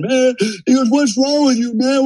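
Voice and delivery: high pleading wail of a beaten man; mock high-pitched whine from the ground; whiny beaten guy. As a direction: cry it out like he's begging. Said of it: "screaming and hollering".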